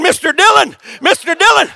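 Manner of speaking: a playful hint of a limping deputy calling out; Chester hollering. Preacher joking about how he looked running, mock hollering. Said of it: "if I'd have been hollering".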